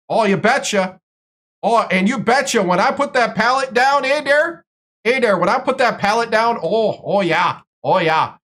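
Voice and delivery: a hint of Upper Midwest "oh, you betcha" lilt; Wisconsin Scotty accent. Playing a cheerful Wisconsin local, in a light Wisconsin accent. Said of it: "Get out my Scotty accent".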